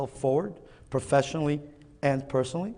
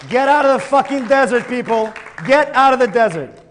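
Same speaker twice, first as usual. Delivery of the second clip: higher, much louder call to action; rallying shout; 9 semitones higher, 8 dB louder than usual. Real, not acted. Speaker to summit audience, rousing shout. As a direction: rouse the crowd, shout it out.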